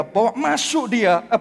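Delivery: ordinary delivery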